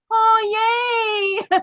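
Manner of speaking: high, loud squeal of joy; retold delight. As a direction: act out a thrilled fan cheering. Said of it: "I was like".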